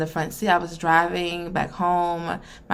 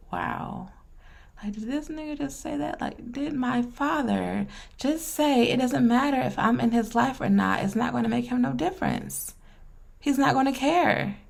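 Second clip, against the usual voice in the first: higher, hushed and stunned, disbelieving; hurt disbelief at the father's words, pitched up and soft; stunned inner voice; 6 semitones higher, 4 dB quieter than usual. Retold or imitated. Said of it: "I was just like".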